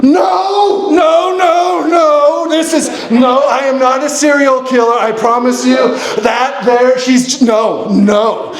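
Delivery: high-pitched frantic protest, easing off; panicked denial. Retold or imitated. Hiker assuring frightened strangers he's harmless, frantic and high. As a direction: start high on the noes and plead your innocence.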